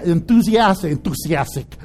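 accidental slip into a Mexican accent; "enthusiastic" low, slipping Mexican-accented. Real, not acted. Preacher stumbling over a word, accidental Mexican accent. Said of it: "I got Mexican all of a sudden".

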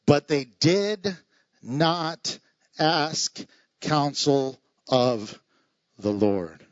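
But they did not ask counsel of the Lord, each word slow and separate, falling low at the Lord; slow emphatic reading. Shown as a demonstration. Reading the key verse to the congregation, slow and heavy. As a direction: drag out every word of the verse. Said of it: "here you go".

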